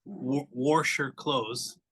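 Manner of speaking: "wash" with an R put in, like "warsh"; added-R Midwestern pronunciation. Retold or imitated. Imitating a Midwestern mother-in-law, added R in "wash". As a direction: say "wash" as "warsh", drawn out slowly. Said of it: "she would add R's".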